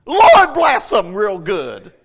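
slow, loud spoken blessing. Shown as a demonstration. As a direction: bless them out loud, drawn out. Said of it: "Do it openly".

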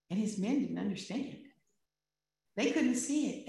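ordinary talk

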